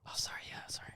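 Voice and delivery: hushed sorry whisper; apologetic whisper. Pretend. Pretend library bit, apologising.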